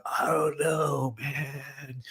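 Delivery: slow, fading "I don't know, man"; SoCal hippie drawl. Retold or imitated. Narrator imitating the dazed coworker, slow soft hippie drawl. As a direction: let it trail off like a spaced-out hippie. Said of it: "old school SoCal hippie drawl".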